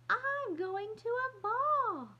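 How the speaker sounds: put-on high, slow, loud little-girl doll voice; high pretend voice. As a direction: drag it out high and loud, playing grown-up. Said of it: "in a high pretend voice".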